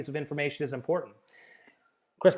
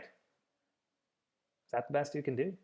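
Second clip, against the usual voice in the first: Is that the best you can do, slow and soft with a rise on best; a slow, quiet haggling question; slow, soft, drawn-out ask; 8 dB quieter, much slower than usual. Retold or imitated. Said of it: "talking with contractors".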